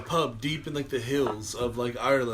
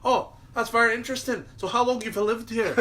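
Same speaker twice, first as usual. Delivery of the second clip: higher-pitched mock Irish politeness; Irish news reporter; 7 semitones higher, 3 dB louder than usual. Irish reporter calmly questioning old man, mock accent. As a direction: ask politely in a lifted Irish lilt. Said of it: "The news reporter".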